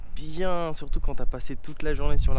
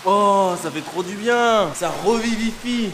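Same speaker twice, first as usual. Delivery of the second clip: exhilarated; 5 semitones higher, 7 dB louder, slower than usual. Real, not acted. Relief after cold plunge.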